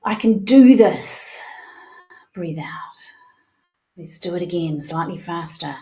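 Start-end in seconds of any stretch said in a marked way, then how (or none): 0.0-1.4 louder, slower, emphatic; loud affirmation. Shown as a demonstration. Coach modelling the affirmation for the group, loud and firm. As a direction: say it like you mean it. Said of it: "say either to yourself or out loud".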